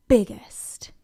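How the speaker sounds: whispered troll warning. Acted.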